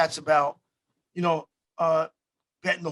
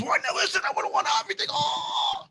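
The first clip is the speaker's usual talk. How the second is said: much higher, whiny mock complaint; mock whine; 14 semitones higher than usual. Friend moaning he'd have won everything, in a squeaky mock whine. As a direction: squeak it out like a sore loser. Friend whining after missed win.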